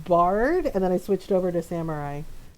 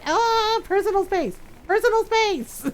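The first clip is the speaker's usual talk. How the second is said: high, yelped protest; mock alarm; 13 semitones higher, 3 dB louder than usual. To characters crowding her, mock-alarmed protest. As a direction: yelp it, then insist in a raised pitch.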